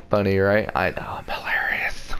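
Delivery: whispered smug aside. Real, not acted. Gamer whispering to viewers about his own pun. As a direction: lean in and whisper it, pleased with yourself.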